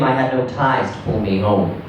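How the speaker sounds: ordinary delivery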